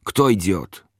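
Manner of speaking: understated shouted command; mild narrated yell. A narrator's reading. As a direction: read a soldier's shout without really yelling. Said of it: "i wrzask".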